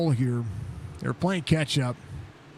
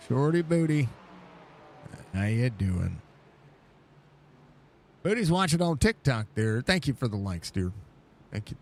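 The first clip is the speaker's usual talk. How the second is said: usual speaking voice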